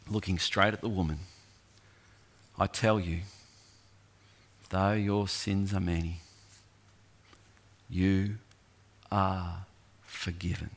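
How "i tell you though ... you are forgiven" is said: tender forgiveness. Acted. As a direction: lay forgiveness gently on her. Jesus forgives the woman, slowing.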